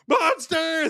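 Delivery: high, loud mock panic cry; freaked-out shout. Audience spots the monster on stage, freaked-out shout. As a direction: imitate a horrified crowd yelling. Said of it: "They all start freaking out".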